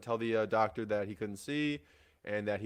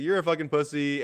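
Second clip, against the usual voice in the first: louder, higher retold taunt; mock yell; 5 semitones higher, 7 dB louder than usual. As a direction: imitate an aggressive insult, raised voice. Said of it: "like, screaming at you".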